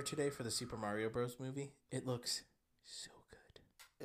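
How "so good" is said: whisper. Real, not acted. Whispering excitement.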